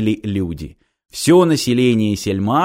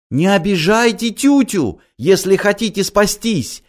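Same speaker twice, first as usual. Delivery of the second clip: much higher, desperate wail; pleading holy fool; 9 semitones higher than usual. A narrator's reading. Holy fool begging the crowd while dragged. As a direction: beg while being dragged away. Tyutya struggling with engineer.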